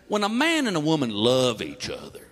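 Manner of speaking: low, slow and gentle; careful fatherly explaining. Retold or imitated. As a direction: ease into it like a nervous dad. Dad explains awkwardly to son.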